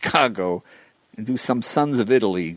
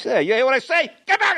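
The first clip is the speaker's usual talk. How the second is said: mock angry shout. Priest yelling back at the gangster at the dinner, mock angry shout. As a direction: play the furious priest about to swing.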